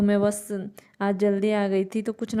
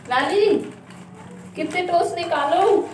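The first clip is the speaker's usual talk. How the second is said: calling out; 9 semitones higher than usual. Real, not acted. Calling daughter from kitchen.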